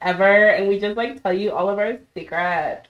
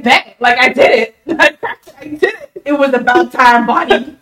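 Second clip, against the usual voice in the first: loud, laughing victory cry; laughing triumph; 4 semitones higher, 7 dB louder than usual. Retold or imitated. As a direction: crow it out through laughter. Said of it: "And I was like".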